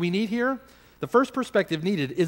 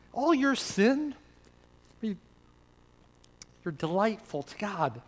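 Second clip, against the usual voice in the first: much softer and slow, almost breathed; hushed tender assurance; 2 semitones higher, 5 dB quieter, much slower than usual. Real, not acted. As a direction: drop right down and tell them gently. Preacher drops to hushed tenderness.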